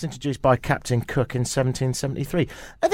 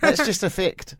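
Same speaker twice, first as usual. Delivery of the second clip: put-on higher, insistent Kiwi-accented quote; mock New Zealand accent; 8 semitones higher than usual. Host quoting the official defending his figures, mock New Zealand accent. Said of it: "he goes on to say".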